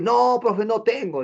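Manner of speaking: high mock student whine; whiny student excuse. Mocking a student who says he has nothing. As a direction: mimic a student dodging the teacher.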